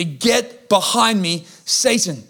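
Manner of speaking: slow, weighty command; stern rebuke. Acted. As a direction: rebuke a follower, spacing out each word. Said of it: "the greatest rebuke in the Bible".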